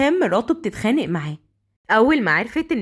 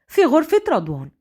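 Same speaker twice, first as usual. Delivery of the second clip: plain reading voice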